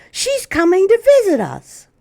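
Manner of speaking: put-on high, loud child shout; excited shout. Child shouting Grandma's visit, high and excited. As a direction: shout it out with delight. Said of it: "shouted the other".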